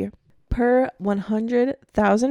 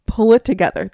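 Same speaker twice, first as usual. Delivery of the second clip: louder, scolding the listeners; a sudden playful telling-off; mock scolding; 8 dB louder than usual. Host telling American listeners off over prison figures, mock scolding.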